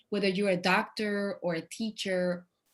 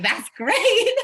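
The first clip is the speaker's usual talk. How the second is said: much higher and louder, bursting with glee; mock delight; 13 semitones higher, 12 dB louder than usual. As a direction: gush it like amazing news. Mock delight at getting salary.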